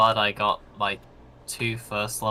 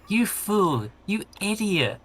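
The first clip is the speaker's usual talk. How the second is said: mock scolding; 9 semitones higher than usual. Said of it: "You literally just went".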